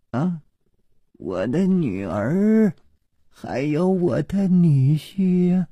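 put-on high, slow, drawn-out old nanny voice; old nanny answering. A narrator's reading. 梁妈拖长声音答话. (老妈子拖长高声答话)

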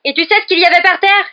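high, loud accusing shout; frantic, furious shouting; mad with rage. A narrator's reading. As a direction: yell it as an accusation. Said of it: "fou de rage".

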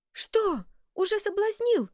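high, loud shocked yell. A narrator's reading. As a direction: wail it out in shock. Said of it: "завопил тот рыцарь".

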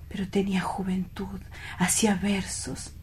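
hushed and far away in the past; dreamy whisper. Acted. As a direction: whisper it like a treasured memory. Lovesick man recalls his youth.

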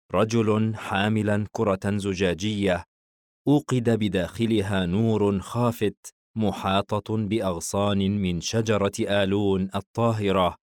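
ordinary delivery, nothing marked

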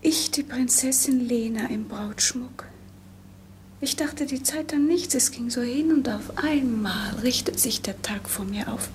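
tonlos geflüstert, kaum hörbar; flüstere es wie zu dir selbst; whisper. Acted. Lena im Brautschmuck, verzagt. (leises, tonloses Flüstern der Braut)

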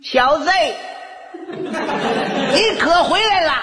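high, drawn-out menacing greeting; lying-in-wait mother. Acted. Mother catches her son sneaking home, high drawn-out menace. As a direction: stretch it out high, pouncing on the son.

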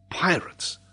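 high, rising alarm, repeated with growing force; shocked nobleman's outcry; alarmed cry. A narrator's reading. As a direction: cry out in sudden horror. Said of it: "cried Glenarvan".

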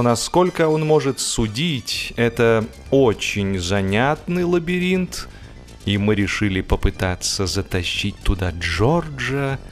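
ordinary delivery, nothing marked

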